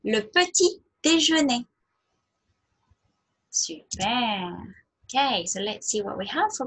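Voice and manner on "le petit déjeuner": clear, raised teacher model of "Le petit déjeuner"; model French word. Shown as a demonstration. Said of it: "Shall we try again?".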